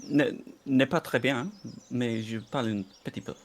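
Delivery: halting French with French pronunciation; speaking French. Shown as a demonstration. Friend demonstrates for the streamer, speaking French. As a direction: modest little burst of French. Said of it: "I like his French sometimes".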